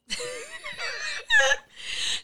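high laughing burst. Real, not acted. Lady K laughing while telling the crowd, high burst. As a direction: crack up.

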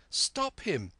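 high, urgent alarm; high-pitched cry of recognition; boy's cry. A narrator's reading. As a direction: raise the alarm in a high young voice. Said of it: "I cried".

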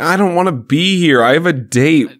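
high, exasperated mock protest; hammed-up reluctant whine; mock complaint. [name] wishing he was elsewhere, mock exasperated complaint.

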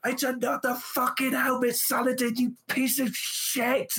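very high, strained mock scream; mock rage scream. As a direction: shriek it at the game in fake fury. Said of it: "And I'm like".

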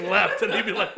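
normal talking voice

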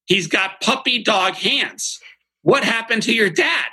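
put-on high, slow little-girl voice. Kindergartner asking about a dad's hands, high child voice. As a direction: play a blunt kindergartner staring at his hands. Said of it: "the little girl goes".

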